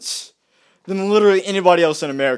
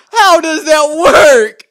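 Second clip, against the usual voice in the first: higher, loud, slow cry of disbelief; incredulous outcry; 9 semitones higher, 11 dB louder, much slower than usual. Real, not acted. Ranting at critics, loud incredulous cry. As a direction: stretch it out in angry disbelief.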